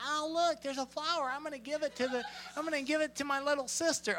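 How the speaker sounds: high, soft, dainty mock voice; mock someone sweetly prissy; prissy kindness. Preacher mocking prissy kindness with flowers, high soft simper. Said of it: "we're not talking about prissy kindness".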